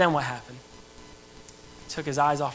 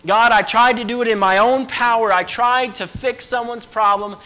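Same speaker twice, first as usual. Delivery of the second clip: higher, louder desperate plea; pleading prayer; 5 semitones higher, 6 dB louder than usual. Acted. Believer crying out to God after failing, louder pleading. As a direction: confess the failure to God, straining.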